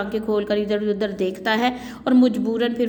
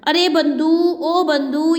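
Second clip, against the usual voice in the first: higher, louder call to the servant; calling loudly; 5 semitones higher, 4 dB louder, much slower than usual. Acted. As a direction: call out across the house. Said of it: "پھر اونچی آواز میں ایک ملازم". (بیگم ملازم کو اونچی آواز میں پکارتی ہے)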